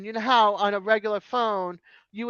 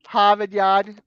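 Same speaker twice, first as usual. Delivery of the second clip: "Harvard Yard" said "Hahvahd Yahd", R's dropped, a bit louder; Boston accent; 2 semitones higher, 5 dB louder, slower than usual. Shown as a demonstration. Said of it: "You drop your R's is what you do".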